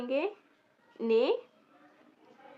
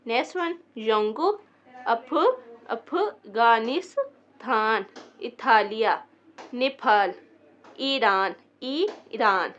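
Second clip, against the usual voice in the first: louder, carefully articulated word list; pronunciation review; 5 dB louder, slower than usual. Shown as a demonstration. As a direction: read each word out clearly for learners. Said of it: "दोबारा pronounce करके दिखा देती हूँ".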